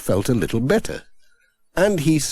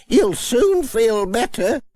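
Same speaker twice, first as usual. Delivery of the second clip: high, slow, kindly grandmother; kindly old granny; 13 semitones higher than usual. Acted. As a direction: ask with grandmotherly concern. Granny Dryden.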